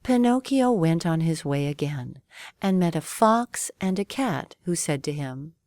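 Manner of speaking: usual speaking voice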